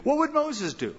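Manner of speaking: lifted, curious question; wondering question. Retold or imitated. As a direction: raise it like a teacher's puzzle. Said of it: "They would say".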